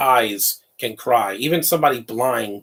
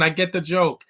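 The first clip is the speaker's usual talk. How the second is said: normal talking voice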